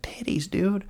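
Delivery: high, soft excited marvelling; mock awe. Being that age and seeing it, giddy awe. Mimics excited young men.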